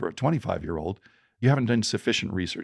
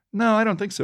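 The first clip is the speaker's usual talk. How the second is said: high protest; 13 semitones higher than usual. Retold or imitated. Retold street argument.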